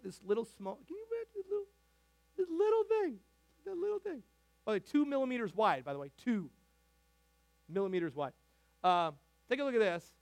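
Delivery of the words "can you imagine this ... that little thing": high squeaky voice, marveling at something tiny; high mock-amazed squeak over a tiny seed; tiny-thing voice. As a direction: squeak it out like it's the tiniest thing ever.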